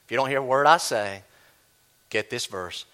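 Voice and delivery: ordinary delivery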